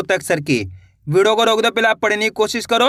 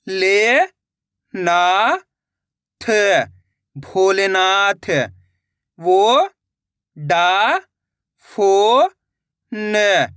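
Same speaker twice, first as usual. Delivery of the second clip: slow, each syllable said on its own with pauses; much slower than usual. Shown as a demonstration. Teacher sounding out words for young readers, slow and syllabic. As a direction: spell it out for beginners, then blend the word. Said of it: "किस तरीके से बड़े-बड़े ओ की मात्रा वाले शब्द पढ़े जाते हैं".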